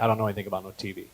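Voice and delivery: low, quiet and rushed; fast hushed tough-guy brush-off; tough guy denial. Retold or imitated. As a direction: mumble it like a streetwise dealer. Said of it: "I was so in character".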